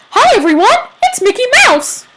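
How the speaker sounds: high, loud squeaky cartoon mouse; chirpy falsetto like a cartoon mouse; Mickey Mouse voice. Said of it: "I wanna hear it".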